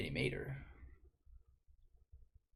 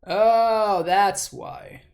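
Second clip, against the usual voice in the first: loud, higher, sudden realization; 6 semitones higher, 10 dB louder, much slower than usual. Real, not acted. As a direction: blurt it out as it dawns.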